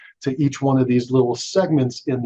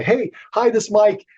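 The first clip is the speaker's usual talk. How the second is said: higher, cheery hello to a caller; friendly phone greeting; 8 semitones higher than usual. Shown as a demonstration. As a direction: greet the caller like an eager salesman. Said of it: "I say".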